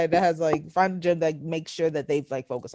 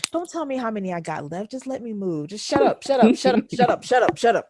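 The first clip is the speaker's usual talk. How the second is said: mock irritation; 3 semitones higher than usual. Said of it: "I wanted to cuss him out".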